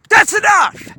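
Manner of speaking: very high, loud shout; heated shout. A narrator's reading. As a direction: break it up with a yell. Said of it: "Wasabi shouted heatedly".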